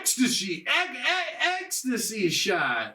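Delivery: stuttering mock announcer call; game sound effect. Imitating the game's repeated sound effect, mock announcer. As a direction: stutter it, then draw out the last call. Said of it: "the only sound effect is".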